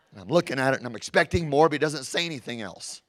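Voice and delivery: normal talking voice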